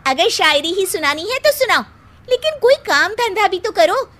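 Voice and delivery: normal talking voice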